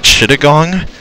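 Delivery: ordinary talk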